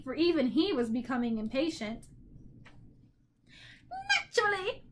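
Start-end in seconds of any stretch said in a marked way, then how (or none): 4.2-4.8 high, cackled reply; cackling bird. A narrator's reading. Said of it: "the bird cackled".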